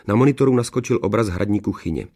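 usual speaking voice

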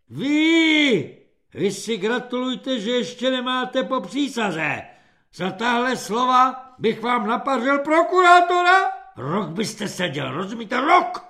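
high loud threats; threatening yell. A narrator's reading. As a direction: threaten him at full volume. Corporal threatening recruit.

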